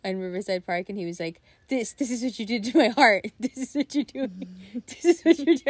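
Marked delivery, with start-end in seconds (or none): 1.7-5.6 high, loud mock-wounded wail; hammed-up heartbroken imitation, laughter creeping in; melodramatic boyfriend. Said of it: "You're so dramatic".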